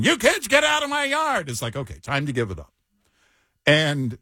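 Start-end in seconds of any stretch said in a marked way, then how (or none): 0.0-1.5 hammed-up, high-pitched old-crank yell; mock cranky screaming. Host parodying himself past retirement age, mock screaming. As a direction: screech it like a grouch chasing off trespassers. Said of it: "just keep screaming".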